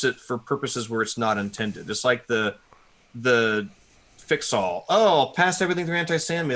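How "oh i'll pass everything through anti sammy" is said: higher-pitched mock eager user; mock naive enthusiasm. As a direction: imitate someone naively delighted by a quick fix. Mocking naive overusers of a tool.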